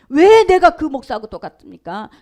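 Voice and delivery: 왜 pushed loud with a rising question; angry protest. Retold or imitated. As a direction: demand 왜 loudly, voice rising. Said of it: "목사님이 화가 난 거예요".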